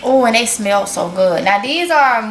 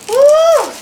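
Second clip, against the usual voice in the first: high, loud "woo"; delighted whoop; 6 dB louder than usual. Real, not acted. Cheering over her greens.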